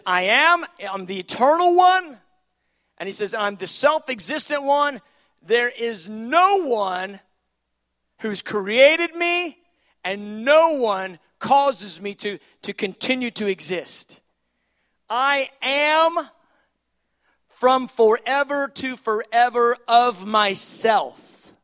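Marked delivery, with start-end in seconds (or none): 0.0-2.2 high, loud divine proclamation; emphatic declaration. Acted. God declaring himself to Moses, loud and emphatic. Preacher voices God's declaration. 3.3-21.5 loud, slow, raised divine declaration; forceful proclamation. Acted. God telling Moses nobody created him, slow forceful proclamation.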